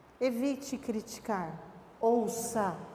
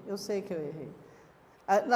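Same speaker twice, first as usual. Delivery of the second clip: ordinary delivery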